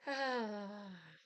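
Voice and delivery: tearful sigh; emotional sigh. Real, not acted. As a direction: let out a moved, tearful sigh. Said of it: "I'm usually not an emotional person".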